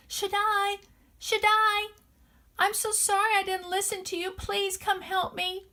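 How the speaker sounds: put-on lost boy calling out high, pleading, slowing at the end. Lost boy calls to Shaddai for help, high pleading call. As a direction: cry out for the maker from the dark woods. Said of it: "Will he hear me if I call to him".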